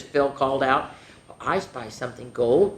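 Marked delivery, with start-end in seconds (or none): none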